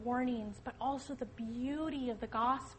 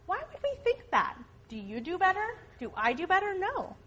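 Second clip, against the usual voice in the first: higher, louder rhetorical questions; emphatic; 7 semitones higher, 7 dB louder than usual. Real, not acted. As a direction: push the point home.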